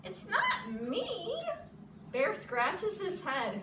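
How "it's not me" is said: high, slow squeak of a little mouse; squeaky mouse. Acted. Mouse squeaking up from the tree, high and small. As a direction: lightly squeak it like a tiny mouse.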